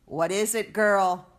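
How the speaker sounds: put-on a loud, nasty bark at the girl; mean and foul. Border agent barks at the frightened girl, mean and loud. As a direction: snarl it like a cruel border agent. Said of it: "asks the agent, mean and foul".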